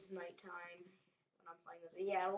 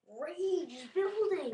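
high, loud, drawn-out hammed-up roar; mock powering-up cry; 11 semitones higher, 11 dB louder, much slower than usual. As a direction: wail it like a Pokemon charging up. Acting Pokemon powering up.

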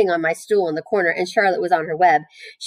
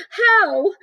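very high, piping question; anxious squeal; 14 semitones higher, 4 dB louder than usual. A narrator's reading. Wilbur asks how Charlotte will save him, squealing. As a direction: squeal it in urgent disbelief.

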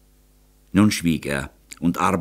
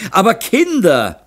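high, loud anxious protest; worried cry; 13 semitones higher, 6 dB louder than usual. A narrator's reading. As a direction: cry out after them in worry. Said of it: "schrie in Sorge". (besorgter, hoher Aufschrei)

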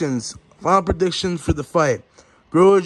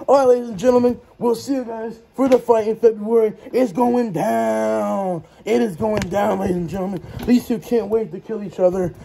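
hammed-up announcer drawing out the opening; press conference host; 4 semitones higher than usual. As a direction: sell the fight like a promoter.

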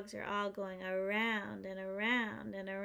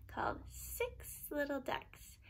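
hushed instructions; 4 dB quieter than usual. Real, not acted. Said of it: "explaining the quack game".

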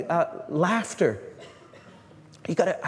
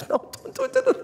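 high whispered "don't, don't", much higher; mock frantic warning. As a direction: hiss a panicky warning like a horror-film viewer. Warning a character in a story.

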